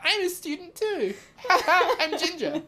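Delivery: high, laughing put-on voice of a dopey creature; mocking Ron voice. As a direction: play the ginger abomination high and silly. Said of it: "this fucking abomination ... is like".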